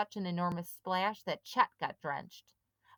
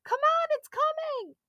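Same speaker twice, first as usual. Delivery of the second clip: very high, loud terrified screams; screaming; 9 dB louder than usual. A narrator's reading. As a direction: scream him out of the water. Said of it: "They all screamed at Sid".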